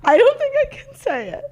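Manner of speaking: high, loud, helpless laughing squeal; laughing near tears. Real, not acted. Too embarrassed to confess to co-host, high laughing squeal. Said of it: "I'm literally gonna cry".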